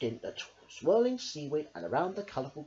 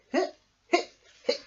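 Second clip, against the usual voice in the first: little hiccups; 5 dB louder than usual. A narrator's reading. Gentle hiccups.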